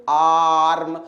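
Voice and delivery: "आर्म" held long on a low "aa"; drawn-out long vowel. Shown as a demonstration. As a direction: stretch the "aa" in "आर्म" for students to repeat. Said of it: "हम word को खींच रहे हैं". ("आर्म" का "आ" खींचकर)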